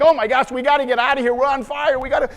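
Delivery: very high, loud, fast panic; hysterical wife. Retold or imitated. Wife trapped by her seatbelt, acted hysteria. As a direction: imitate her frantic shrieking, words tumbling out. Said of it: "she's hysterical".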